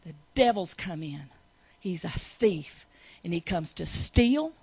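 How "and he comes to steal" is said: ordinary talk, nothing marked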